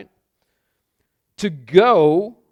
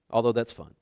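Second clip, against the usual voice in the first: usual speaking voice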